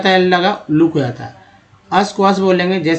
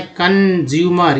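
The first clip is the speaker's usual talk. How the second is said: Consumer stretched slowly; slow model word; much slower than usual. Shown as a demonstration. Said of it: "कन को लेकर". (Consumer धीरे-धीरे)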